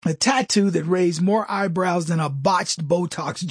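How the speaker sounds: usual speaking voice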